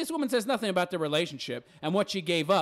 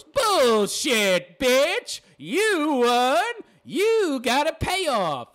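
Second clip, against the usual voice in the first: loud, raised-pitch shouting; outraged retort; 6 semitones higher, 4 dB louder, much slower than usual. Real, not acted. Commentator rebutting the divorced wife, outraged shouting. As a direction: yell it out, drawing out each word.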